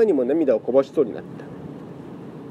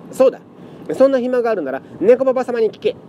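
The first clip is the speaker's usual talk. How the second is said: eager shout; 9 semitones higher than usual. Acted. Gambler cat shouts eagerly.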